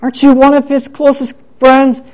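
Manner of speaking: mock-curious accusers; prying bystanders. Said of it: "some people ask Peter".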